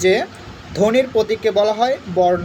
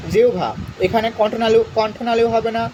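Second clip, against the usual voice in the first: usual speaking voice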